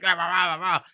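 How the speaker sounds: mock ranting noise. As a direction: yap out wordless mock arguing. Mimics ranting commenter.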